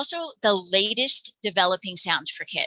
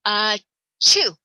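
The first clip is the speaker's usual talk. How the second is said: loud "chew" like a pretend sneeze; mock sneeze; 6 dB louder than usual. Shown as a demonstration. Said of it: "pretend that they are sneezing".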